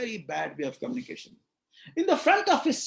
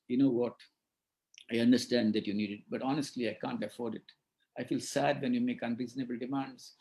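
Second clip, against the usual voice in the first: lower, soft and calm; gentle honest father; 9 semitones lower, 5 dB quieter than usual. Shown as a demonstration. Father explaining to son he can't afford it, gently. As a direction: let him down gently and kindly. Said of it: "You can say".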